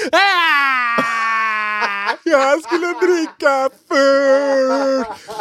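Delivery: put-on high, loud sobbing shout of a little girl; crying child's wail. Little sister crying to her mother, high wailing shout. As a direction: wail it like a wronged toddler. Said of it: "hon blir så här".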